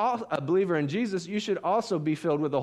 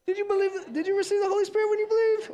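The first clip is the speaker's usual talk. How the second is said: squeaky, very high and hurried question; comic high squeak, fast; put-on questioning impression; faster than usual. Shown as a demonstration. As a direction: rush it out in a high falsetto. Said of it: "Which one was it?".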